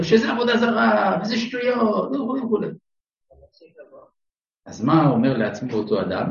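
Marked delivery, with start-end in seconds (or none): 0.0-2.6 high-pitched mock preacher denouncing; lightly hammed-up rabbi railing against football; imitating fiery sermon. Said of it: "חוצבת להבות נגד הכדורגל".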